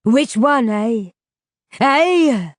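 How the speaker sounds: loud threatening demand. Acted. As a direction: press them, menacingly. Rubers threatening.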